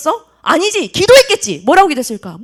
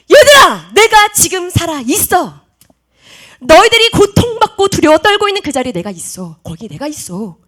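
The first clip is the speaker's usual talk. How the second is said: loud, emphatic divine declaration; impassioned preaching as God; 2 semitones higher, 8 dB louder than usual. Acted. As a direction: proclaim it forcefully to frightened believers.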